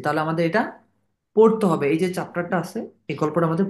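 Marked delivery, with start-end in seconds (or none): none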